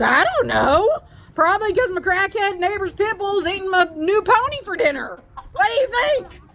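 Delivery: high, frantic mock sarcasm; hammed-up exasperated caller; exasperated retort. Retold exasperated answer to dispatcher.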